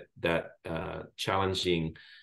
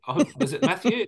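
higher, puzzled mishearing; confused caller; 11 semitones higher than usual. Retold or imitated. Caller on the phone mishears Bashir, puzzled higher voice. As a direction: play the caller who can't catch the name.

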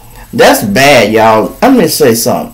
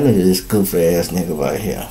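muttered aside; 9 semitones lower, 9 dB quieter than usual. Acted. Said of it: "let that shit pass like".

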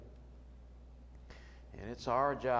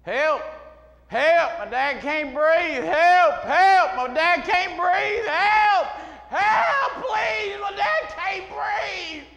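high, loud, desperate cries for help, slowing on the last; reliving a frantic ER scream; retold screaming; 15 dB louder, much slower than usual. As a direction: scream for help as if your father is dying. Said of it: "And so I started screaming".

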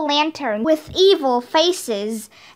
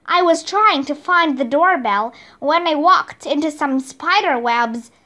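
ordinary delivery

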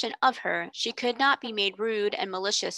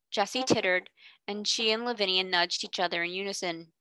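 ordinary delivery, nothing marked

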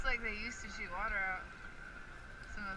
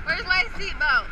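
alarmed shout; 7 semitones higher, 11 dB louder than usual. Real, not acted. Alarmed on a water ride.

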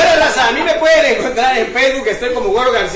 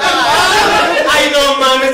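loud, higher shout with a laugh; repeated punchline shout; 6 semitones higher, 7 dB louder, much slower than usual. Acted. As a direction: hammer the punchline home at him. (grito fuerte del remate, con risa)

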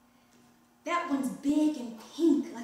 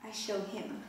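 weak murmur; 4 semitones lower, 11 dB quieter than usual. Acted. Dazed girl after nearly drowning.

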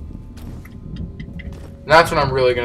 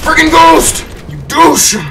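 high, loud furious yell at the ghost; angry shout; 12 semitones higher, 5 dB louder than usual. Real, not acted. Gamer yells at a ghost that jumped him, angry shout. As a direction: blast the ghost with anger.

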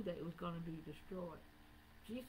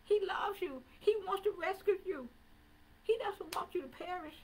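high, loud pleading sermon cry; fiery preaching; 13 semitones higher, 7 dB louder than usual. Acted. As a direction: plead with them at full force. Preacher pleads with rising passion.

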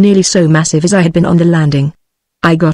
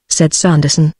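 ordinary delivery, nothing marked

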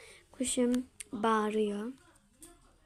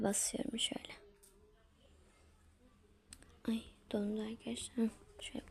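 whisper; 9 dB quieter than usual. Real, not acted. Whispering while drawing on phone.

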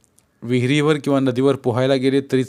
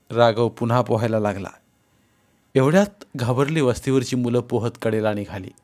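ordinary delivery, nothing marked

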